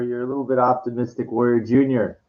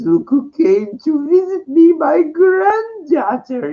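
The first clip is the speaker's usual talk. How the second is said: much higher, drawn-out put-on villain voice; mock Palpatine; 14 semitones higher, slower than usual. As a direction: sneer it up high like a creepy grandfather.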